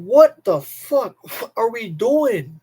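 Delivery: ordinary talk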